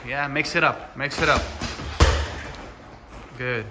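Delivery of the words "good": ordinary talk, nothing marked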